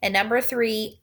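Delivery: ordinary talk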